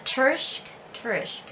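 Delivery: Tursk tried out as "TUR-ishk"; unsure pronunciation. Shown as a demonstration. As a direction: attempt Tursk with a sh sound before k. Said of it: "let me know how to pronounce that".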